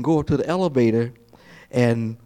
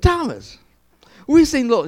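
excited news; 13 semitones higher, 6 dB louder than usual. Retold or imitated. Disciples calling to Thomas.

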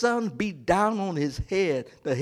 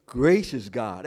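ordinary talk

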